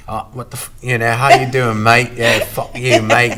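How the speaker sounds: put-on Cockney accent. Host and friend [name]'s running bit, mock Cockney accent. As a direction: swear like a mate in put-on Cockney. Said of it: "It's fun to do a Cockney accent".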